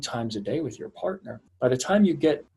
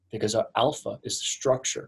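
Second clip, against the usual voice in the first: ordinary delivery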